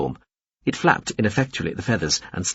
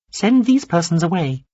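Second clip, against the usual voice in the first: a small alien addressing Veppers carefully; high alien voice; 9 semitones higher, 4 dB louder than usual. A narrator's reading. Alien orders the women out.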